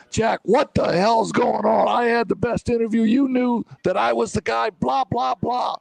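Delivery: high-pitched mock fury at the future boss; retold angry rant. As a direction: replay the outburst, hammed up and high. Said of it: "And I'm swearing".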